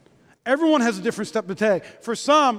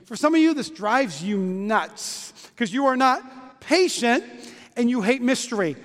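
ordinary delivery

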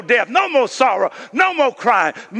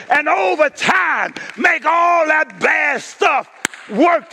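full-force preaching; 6 semitones higher than usual. Acted. Pastor preaching to the church, calling out at full force. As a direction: preach it hard to the congregation.

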